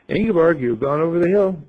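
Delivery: lightly voiced jeering gang; teasing friends. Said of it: "And they will think".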